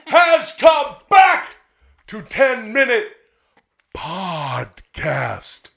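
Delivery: high, slow, shouted announcer voice. Acted. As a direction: hype it like a wrestling announcer. Wrestling announcer hype.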